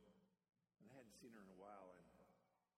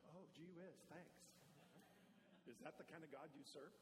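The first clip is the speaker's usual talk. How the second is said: sarcastic God; 4 semitones higher than usual. Retold or imitated. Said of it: "And God goes".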